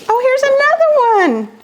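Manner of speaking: gasping with delight at the next gift; excited. Real, not acted. Vlogger finding another gift in the package, thrilled squeal. Excited unboxing friend mail.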